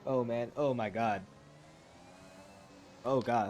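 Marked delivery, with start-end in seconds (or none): none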